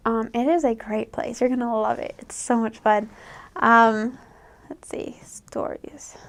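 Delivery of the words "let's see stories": whispered aside. Real, not acted. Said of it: "whispers to herself".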